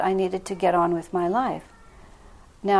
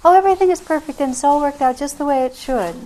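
higher, louder, sugary fake positivity; mock cheerful denial; 7 semitones higher, 7 dB louder than usual. Mocking how not to talk to Divine Mother, fake cheer. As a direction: parody the forced everything-is-fine voice. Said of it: "You don't say".

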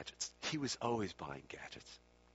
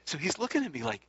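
ordinary delivery

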